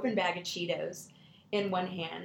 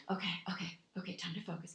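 very soft self-calming murmur; imitating a child settling herself; focusing little girl; 8 dB quieter, faster than usual. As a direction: psych yourself down under your breath. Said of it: "She's taking deep breaths".

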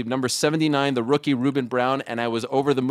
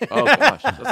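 high, loud startled exclamation; surprised; 11 semitones higher, 7 dB louder, slower than usual. Real, not acted. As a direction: caught off guard, blurt it. Reacting to the win-or-lose dilemma.

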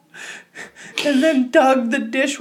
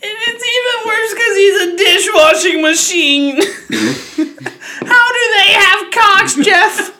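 crying with laughter; 9 semitones higher, 4 dB louder than usual. Real, not acted.

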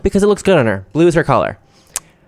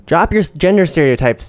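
usual speaking voice

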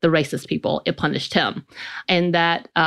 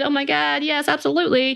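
higher, bright burst of gushing delight; mock elated parents; 9 semitones higher than usual. Parents cheering the engagement, imitated with excited joy. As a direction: play the overjoyed parents hearing the news.

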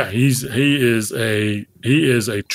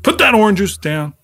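sharp scolding, higher and louder; a harder take of the order; angry parent; 8 semitones higher, 4 dB louder than usual. Acted. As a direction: snap the order at the kid.

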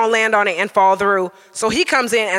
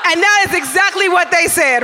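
normal talking voice

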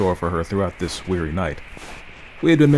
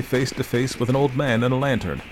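usual speaking voice

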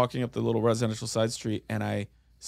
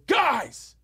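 retold yell; 12 semitones higher than usual. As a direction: shout it from far away. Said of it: "I go".

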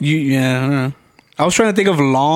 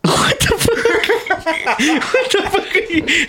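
laughing; slower than usual. Real, not acted. Cracking up at the Latin answer.

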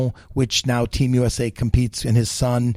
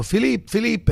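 higher-pitched mock call across the kitchen; retold calling out; 9 semitones higher than usual. Old chef calling his young cook over, in a mild mock yell. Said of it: "Verger yells".

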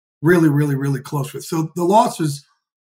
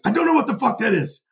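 Australian boss; 8 semitones higher than usual. Retold or imitated. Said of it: "he's Australian".

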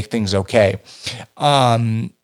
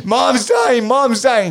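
much higher, shouting in alarm; panicked yell; 13 semitones higher, slower than usual. Retold or imitated. Terrified son yelling to dad that mom is dying, shouted high. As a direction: cry out in terror that she's dying.

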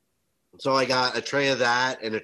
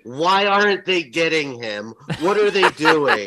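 loud, higher, baffled; hammed-up frustrated fan; mock yell; 5 semitones higher, 8 dB louder than usual. Mom shouting at the TV, loud baffled yell.

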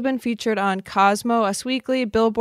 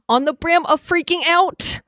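Brittany Cartwright impression; 8 semitones higher, 4 dB louder than usual. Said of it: "As Brittany Cartwright would say".

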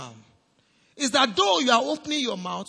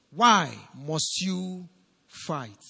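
slow fading repeat; 4 semitones lower, much slower than usual. Acted. Preacher repeating the question, slow, fading low. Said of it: "repeated slower with fall".

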